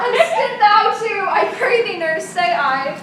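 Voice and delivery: loud and high, ordering her to stop; loud command. Acted. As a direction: shut the nurse up at last. Lady Capulet silences the laughing nurse.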